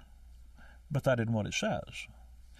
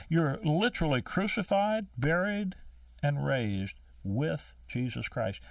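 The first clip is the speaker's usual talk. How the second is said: ordinary talk, nothing marked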